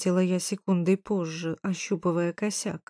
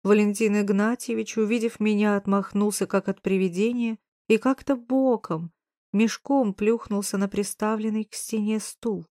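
usual speaking voice

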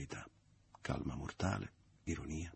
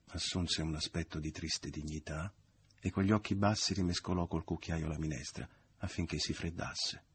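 ordinary delivery, nothing marked

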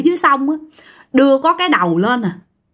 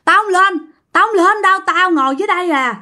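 high, stubborn shouted refusal; high-pitched defiant refusal; defiant yelling; 8 semitones higher than usual. Retold or imitated. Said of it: "la quá trời".

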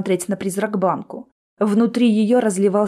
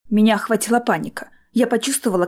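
ordinary delivery, nothing marked

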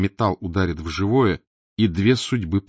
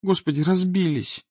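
higher dismayed cry; girl gasping; 10 semitones higher than usual. Acted. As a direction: gasp over the broken sunglasses. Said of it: "охнула". (девичий возглас огорчения)

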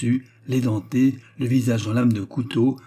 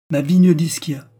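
loud, higher desperate stake; crying out; 6 semitones higher, 7 dB louder than usual. Acted. Pulcinella staking his vineyard, crying out. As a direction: shout the stake. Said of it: "s'écria Pulcinella".

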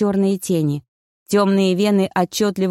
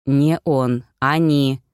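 lightly voiced lower male figure; correcting; 5 semitones lower, much slower than usual. A narrator's reading. Koschei corrects Yaga about who succeeded, firm. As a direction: correct her firmly in a lower voice. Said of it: "поправил Кощей".

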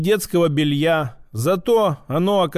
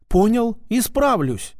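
higher-voiced, fawning compliance; obsequious; 5 semitones higher than usual. A narrator's reading. Shopkeeper placates the gendarme, obsequious. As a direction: promise eagerly through an ingratiating smile. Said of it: "снова расплылся в угодливой улыбке мужичок".

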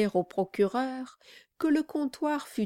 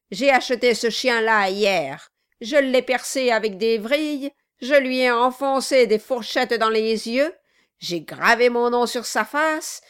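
loud, raised, relishing cruelty; menacing dwarf; 3 semitones higher, 6 dB louder than usual. Acted. Quilp boasts to Brass of torturing the figure, gloating. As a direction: gloat over every torment.

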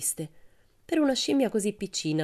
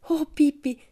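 excited call; 10 semitones higher, 4 dB louder, slower than usual. Acted. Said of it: "gridò Annika tutta su di giri".

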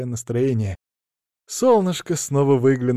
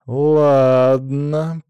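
long, louder drawn-out word; drawled agreement; 3 semitones higher, 6 dB louder than usual. A narrator's reading. Narrator giving in to the director, drawled. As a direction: stretch the first vowel right out. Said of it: "протянул я".

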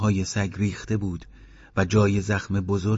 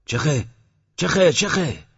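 rising shout, higher on each call; shouting at a dog; 8 semitones higher, 4 dB louder, slower than usual. Acted. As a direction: yell louder and higher to shoo the dog. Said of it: "داد زد".

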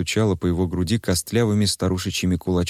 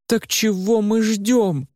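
much higher, urgent, drawn-out cry; slower than usual. Acted. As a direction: shout at him to hurry. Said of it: "закричала Варя". (громкий, высокий, медленный крик Вари)

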